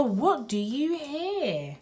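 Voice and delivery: usual speaking voice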